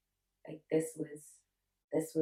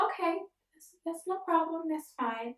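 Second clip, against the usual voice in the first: high, brave-faced "okay"; putting on strength; 11 semitones higher than usual. Retold or imitated. Patient reassures the nurse after bad news, putting on strength. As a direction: act fine, pitched well up. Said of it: "You gotta be strong right now".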